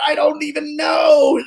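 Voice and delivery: high, shouted disbelief; high-pitched stunned shout; dazed rescuer. Retold or imitated. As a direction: blurt it out like you can't believe it yourself. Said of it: "He said".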